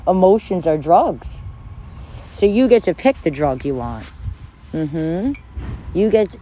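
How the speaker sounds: ordinary talk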